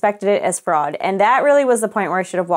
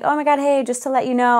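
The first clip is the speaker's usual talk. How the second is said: higher-pitched, overly friendly customer voice; mock chirpy politeness; 4 semitones higher than usual. Customer parodying her earlier polite emails, mock chirpy politeness. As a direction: imitate an apologetic, breezy opener. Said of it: "now I'm not being like".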